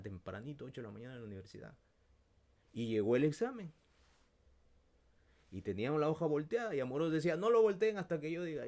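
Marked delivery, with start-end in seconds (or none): 2.7-7.4 loud, rising, fast telling; excited storytelling. Retold or imitated. Relato emocionado del examen. 7.4-8.7 loud, high teacher's order; stern teacher. Retold or imitated. Profesor da orden en clase.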